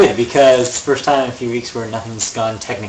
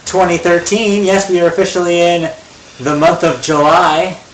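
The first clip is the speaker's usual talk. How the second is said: slow, bright welcome to listeners; podcast host intro; 3 semitones higher, slower than usual. Acted. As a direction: announce the show warmly.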